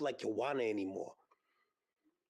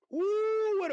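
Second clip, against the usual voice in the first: a high, loud whoop of delight; excited whoop; 6 dB louder than usual. Real, not acted. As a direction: cheer like the fight just ended. Reacting to a great fight.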